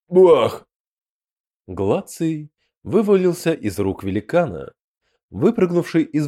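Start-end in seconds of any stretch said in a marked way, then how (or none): 0.1-0.7 sudden loud smash shout, higher and louder; loud impact cry. A narrator's reading. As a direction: blurt a crashing impact noise. Impact sound effect.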